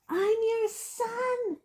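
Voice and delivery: slow, high anguished scream. Acted. Son screaming up at his father, slow high anguish. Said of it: "he screamed up at Crouch".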